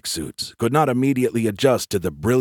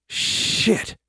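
sharp, breath-heavy startled expletive; startled curse; 13 semitones higher than usual. A narrator's reading. Man hearing destroyers were lost, startled. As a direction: blurt it out on a burst of air. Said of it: "He was so startled".

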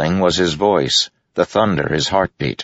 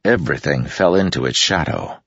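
ordinary delivery, nothing marked

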